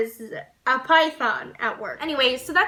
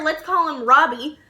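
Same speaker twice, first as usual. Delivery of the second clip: ordinary delivery, nothing marked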